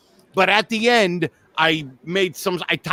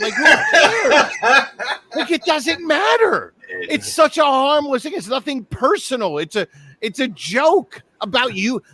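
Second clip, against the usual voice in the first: high-pitched exasperated disbelief; incredulous rant; 6 semitones higher than usual. Real, not acted. Comic defending a harmless joke to friends, incredulous rant. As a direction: argue it high, utterly baffled.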